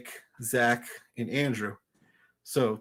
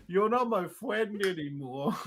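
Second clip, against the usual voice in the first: put-on slow, softer, sulky kid voice; sulking little kid; 4 semitones higher, 4 dB quieter than usual. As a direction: pout it out like a hurt child. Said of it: "he just sits there and he goes".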